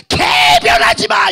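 very high, loud, slow yell; furious shout. Retold or imitated. Aunt screaming at weeping nephew not to argue back. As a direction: cut him off with a scream.

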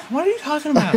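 high squeaky helium voice; chipmunk-pitched question. Real, not acted. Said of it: "You were the one that got high off helium".

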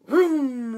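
loud, high vroom, falling; engine vroom. A narrator's reading. Reader voices the dump speeding round a curve, loud vroom. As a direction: rev it loudly like an engine.

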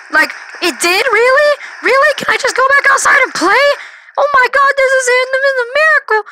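very high, squeaky excited voice; overjoyed kid. Retold or imitated. Eight-year-old told by parents it missed, squeaky excitement. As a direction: squeal with childlike relief.

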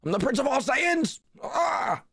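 a hint of Vegeta's arrogance; mock Vegeta boast; Vegeta quote. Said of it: "let's do Vegeta".

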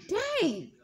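exaggerated, punched-up astonishment; impressed reaction. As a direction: act out someone stunned by a great smell. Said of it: "they gonna be like".

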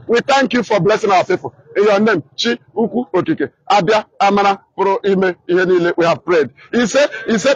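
low, hushed prayer voice; closing prayer. Real, not acted. Broadcaster thanking God for blessing his people, hushed prayer.

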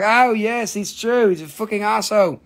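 mock teasing agreement; imitating Nicole. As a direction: play his partner cheerfully agreeing. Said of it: "She's like".

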